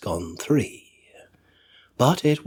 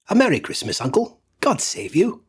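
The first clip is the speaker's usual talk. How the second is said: bright, high cheerful call; cheerful greeting; 7 semitones higher than usual. A narrator's reading. Nephew greets his uncle, high cheerful call. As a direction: nephew bursting in with festive cheer. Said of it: "cried a cheerful voice".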